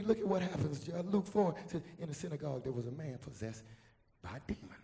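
very soft, suspenseful sermon delivery; hushed preaching. Real, not acted. Preacher leading the congregation into the demon story, hushed preaching. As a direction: drop right down and draw them in.

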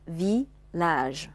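"village" with a short "vi" and a longer "lage"; short first syllable. Shown as a demonstration. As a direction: clip the vi in "village", stretch the lage. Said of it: "发的比较短促一点哈". (village中vi短促、lage拉长)

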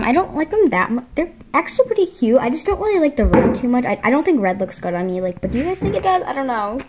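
ordinary talk, nothing marked

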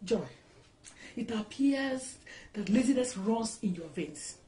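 quiet, slow, measured insult; cold reproach. Acted. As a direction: deliver the judgement slowly and softly. Mother-in-law delivers a cold insult.

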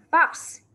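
"fox." set against frog, a short clear English fox; teacher contrasts "fox." with frog; model English word. Shown as a demonstration. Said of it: "con cáo nó khác nè".

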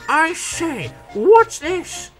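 high, loud yelp of alarm. A narrator's reading. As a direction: cry out in sudden panic. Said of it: "he yelped".

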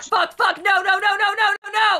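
rising panicked yelling; real panic as she falls. Real, not acted. Falling to death in game.

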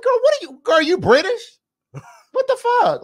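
high-pitched, baffled mock reaction; acting out bewilderment, voice way up; mock incredulous. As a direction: shoot up high in exaggerated disbelief. Acts out baffled reaction to accent.

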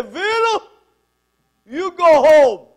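booming, high, drawn-out Italian shout; irate Italian bellowing through the house; Italian accent yell. Retold or imitated. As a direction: holler it loud and slow with an Italian accent. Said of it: "That's Italian".

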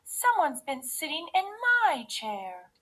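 high, shocked mother-bear voice; Mommy Bear. A narrator's reading. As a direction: say it high, gasping in disbelief. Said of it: "gasped Mommy Bear".

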